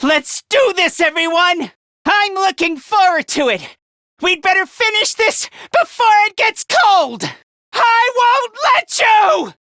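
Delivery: rallying shout, rising in force; battle cry. Acted. Rallying everyone before the fight, shouted battle cry.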